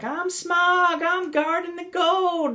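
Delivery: very high-pitched, exaggerated southern drawl; comic southern dragon, stretched out slowly; squeaky southern twang imitation; Smaug. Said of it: "really southern accent".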